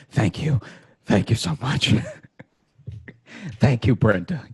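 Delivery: gushing thanks. Retold or imitated. Thanking the bank agent.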